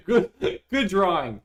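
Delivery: real laughter; laughing. Real, not acted. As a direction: get the words out through laughter. Laughing at a chat drawing.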